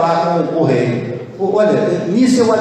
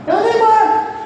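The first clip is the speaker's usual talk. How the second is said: exclaimed name. Real, not acted.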